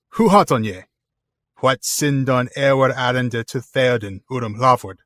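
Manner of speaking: put-on loud, formal challenge of a Rohan gate guard in Old English; stern Old English recitation. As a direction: declaim it like a sentry barring the gate. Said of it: "just read in Old English".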